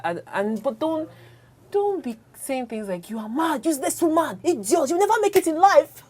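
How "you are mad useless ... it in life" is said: rising, higher, louder jeering quote; mock insult. Quoting partners who insult each other, mock angry. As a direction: spit out the insults like a bad spouse.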